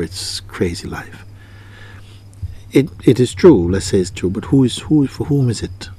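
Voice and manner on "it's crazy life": whispered. Retold or imitated. Voicing the inner complaint.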